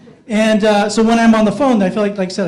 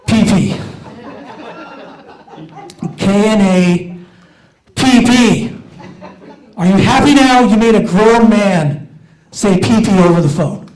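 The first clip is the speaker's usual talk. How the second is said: loud, hammed-up exasperated rant; mock exasperation; 4 semitones higher, 4 dB louder than usual. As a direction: vent at the caller in mock outrage. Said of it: "And I'm like".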